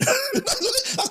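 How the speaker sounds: sudden high burst of laughter. Real, not acted. Laughs at own boast.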